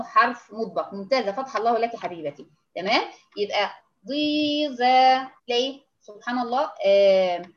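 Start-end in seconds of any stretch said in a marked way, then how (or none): 4.1-5.2 ضيزة drawn out slowly with a heavy emphatic ض; slow demo word. Shown as a demonstration. Teacher models ضيزة slowly for students. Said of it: "الضاد أشد تفخيمًا".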